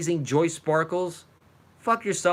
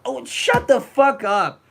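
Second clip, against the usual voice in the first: angry outburst; 5 semitones higher, 5 dB louder, slower than usual. Real, not acted. Snaps at clip of rival.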